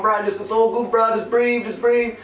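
high, loud hammed-up instructor voice; instructor calming. Instructor mid-air telling the panicking jumper to breathe, high and loud. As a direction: play the instructor urging him to breathe. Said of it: "And the guy's going".

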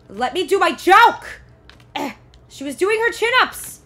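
loud, high, slow complaint; loud protest. Real, not acted. Streamer protesting the interrupted chin-ups, loud high complaint. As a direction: demand it at the screen.

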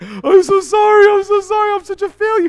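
very high, hammed-up wailing apology; squeaky, over-the-top fake crying; mock crying. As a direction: wail it like a bawling kid, playfully exaggerated. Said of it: "like, crying".

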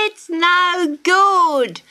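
high, loud, slow laughing bird; laughing kookaburra. A narrator's reading. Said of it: "laughed the kookaburra".

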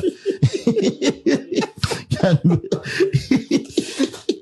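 shrieking high laughter; real amused laugh. Real, not acted. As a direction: crack up in a long high laugh. Host laughing at the story.

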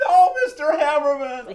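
high, loud, desperate scream of protest; frantic high-pitched shriek to stop him; screaming sister. Acted. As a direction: cry out at the top of your voice. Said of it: "your sister screams".

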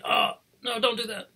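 high-pitched, hammed-up yelp of protest; mock pained protest. Sufferer touched anywhere hurts, mock pained protest. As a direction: plead as if touched on a sore spot.

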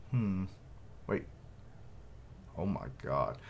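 ordinary delivery

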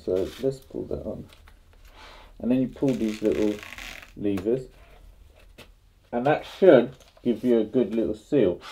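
muffled behind respirator; speaking inside half-face respirator; through a face mask. Real, not acted. Seen 1: Respirator covers nose and mouth throughout; reference frames show face uncovered.

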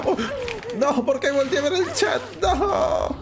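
high-pitched, half-laughing lament; dismayed wail. Real, not acted. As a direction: wail it high, ending on a drawn-out no. Regrets looking away from game. (lamento agudo medio en risa)

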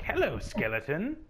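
naive skeleton greeting, hurried at the end; bright goofy Papyrus tone; Papyrus impression. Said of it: "he's like".

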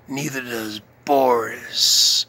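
ordinary talk

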